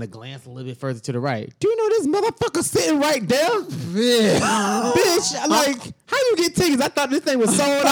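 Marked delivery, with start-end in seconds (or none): 1.6-7.8 high, squealing disbelief; can't believe who's sitting there; shocked disbelief. Retold or imitated. Teller spotting her ex at the show, high shocked squeal.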